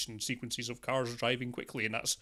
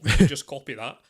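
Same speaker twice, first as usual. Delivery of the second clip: loud mock thought; 14 dB louder than usual. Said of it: "and thought".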